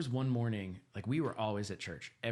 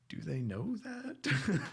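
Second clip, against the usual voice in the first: higher, slow, disbelieving question; incredulous teacher; 10 semitones higher, much slower than usual. Retold or imitated. Teacher asking the boy about his parents, slow and incredulous. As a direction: draw it out in disbelief. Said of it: "And they're like".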